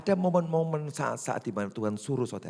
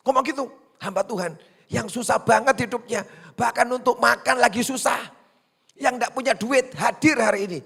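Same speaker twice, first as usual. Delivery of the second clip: much higher and louder; impassioned preaching; 11 semitones higher, 8 dB louder than usual. Real, not acted. Pastor urging the congregation, loud and passionate. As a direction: drive it home with fervor.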